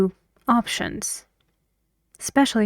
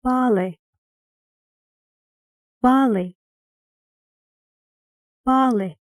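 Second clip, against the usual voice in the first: "Bali" with the beat on "Ba", higher then falling; stressed first syllable; 4 semitones higher, 3 dB louder than usual. Shown as a demonstration. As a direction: model "Bali", stressing "Ba" and dropping "li". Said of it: "guess which syllable is stressed".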